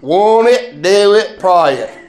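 Southern drawl on want it, do it, pray it; Southern US accent, lightly done. Shown as a demonstration. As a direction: say it the Southern way, won it, prah it. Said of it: "This is how they say it in South".

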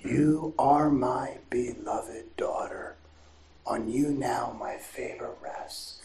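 slow, soft whisper of loving assurance; tender voice of God. Retold or imitated. God speaking to his beloved daughter, slow tender whisper. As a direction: breathe it slowly, like a loving father. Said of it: "imagine God saying to you".

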